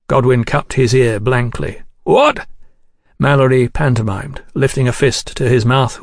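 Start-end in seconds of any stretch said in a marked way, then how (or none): 2.0-2.5 high, questioning call; calling back. Acted. Cannot hear over the racket.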